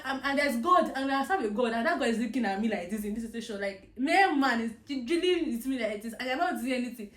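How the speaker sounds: loud, heated protest to God; reliving an angry prayer; angry complaint. Retold or imitated. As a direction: argue with God at full voice. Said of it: "I was so angry".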